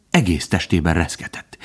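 ordinary delivery, nothing marked

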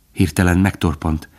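usual speaking voice

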